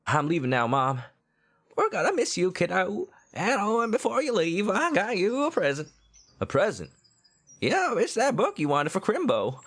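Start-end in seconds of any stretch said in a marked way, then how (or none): 1.8-5.9 high falsetto mom. Acted. Mom voiced in game. 7.6-9.6 high falsetto mom. Acted. Mom voiced in game.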